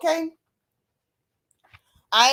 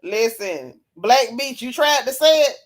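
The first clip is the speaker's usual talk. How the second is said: normal talking voice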